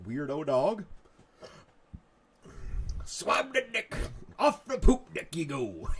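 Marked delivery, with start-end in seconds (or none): 3.0-5.9 pirate voice. Acted. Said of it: "how pirates talk".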